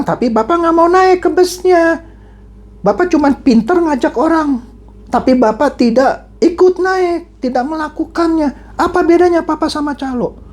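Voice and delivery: high, insistent acted accusation; accusing. Son confronts father who preaches but won't follow, accusing.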